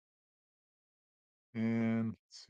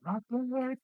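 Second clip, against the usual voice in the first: high-pitched call from another deck. Acted. Garganhale calling to those below deck, high-pitched call. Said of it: "you can hear Garganhale call out".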